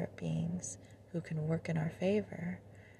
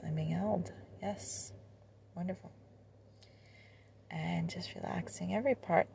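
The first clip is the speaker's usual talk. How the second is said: guided meditation. Acted. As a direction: ease them down part by part. Leads a relaxing body scan.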